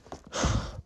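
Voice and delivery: unvoiced-breath sigh to wrap up; audible exhale after the story. Real, not acted. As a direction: let out a real sigh. Winding down the painful story.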